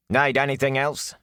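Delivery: a lightly voiced servant asking; attendant's voice. Ara's character voice.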